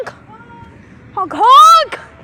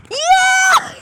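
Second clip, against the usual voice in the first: loud, high cheer; excited whoop; 13 semitones higher, 5 dB louder than usual. Real, not acted. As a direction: yell with glee. Cheering at throwing ice.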